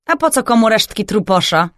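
ordinary delivery, nothing marked

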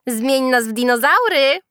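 loud, high, rising chant-like jeer; taunting call. A narrator's reading. Girls tease Julka, calling out loudly. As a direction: call out mockingly, lifting the end. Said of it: "wołały".

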